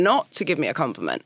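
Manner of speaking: normal talking voice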